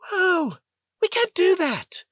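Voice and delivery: stunned disbelief. Acted. Pilot watching the enemy flyer climb straight up, startled. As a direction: gasp it out at the impossible climb. Pilot seeing impossible maneuver.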